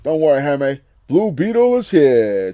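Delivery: loud, slow hero proclamation; booming superhero arrival; big superhero voice calling to Jaime; heroic announcement. Acted.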